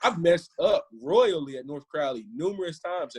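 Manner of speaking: ordinary talk, nothing marked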